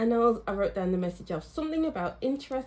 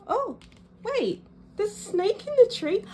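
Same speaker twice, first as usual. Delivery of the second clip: sudden high gasp of surprise at the card; surprised discovery; 12 semitones higher than usual. Real, not acted. Reader spots a snake on her tarot card, surprised. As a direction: catch sight of something new and blurt it out, much higher.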